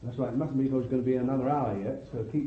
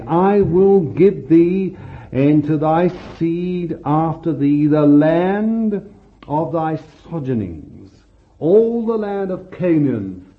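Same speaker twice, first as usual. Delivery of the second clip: loud, slow scripture proclamation; booming pulpit reading; fervent preaching; 3 semitones higher, 7 dB louder than usual. Acted. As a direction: proclaim each phrase with weight. Preaching scripture at full force.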